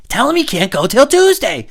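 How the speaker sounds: very high, loud order; insistent yelling parent. Retold or imitated. Parent interrupts child's call, insistent high yelling. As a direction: yell the instruction over the conversation.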